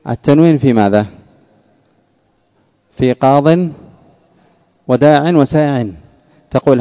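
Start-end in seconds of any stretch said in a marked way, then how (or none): none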